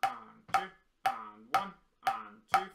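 loud, steady chanted count over the drums; counting in time. Shown as a demonstration. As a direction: call out each beat as you play. Counts aloud over drumming.

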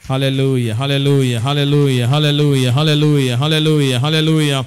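loud, rhythmic praise and pleading; fervent prayer. Real, not acted. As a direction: lead a congregation in impassioned prayer. Leads congregation in fervent prayer.

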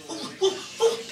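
short, soft rising oohs; acted ooh-ing in bed; mock bedroom panting. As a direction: puff out breathy little oohs. Acts out straining moans in bed.

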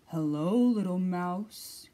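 slow, loud growly greeting; a big growly bear voice; growling Bear. A narrator's reading. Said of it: "growled Bear".

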